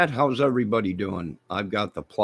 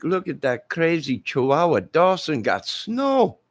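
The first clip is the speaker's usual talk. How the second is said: higher, hammed-up Aussie accent; Australian accent, comic amazement; mock Australian neighbor; 8 semitones higher than usual. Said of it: "They would have been like".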